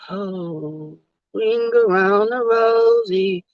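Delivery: slow, dreamy nonsense; spacey dodge. Retold or imitated. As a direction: drift off as if not listening. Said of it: "She just like".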